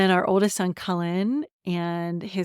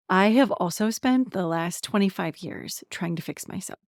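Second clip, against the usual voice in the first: normal talking voice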